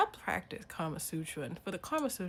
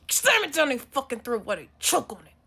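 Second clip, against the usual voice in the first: forceful threat; 4 semitones higher, 10 dB louder than usual. Acted. Acted threat to shove breadcrumbs down.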